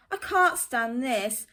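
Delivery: loud, exasperated complaint; fed-up Mister Bear. Acted. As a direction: snap it out, fed up with the noise.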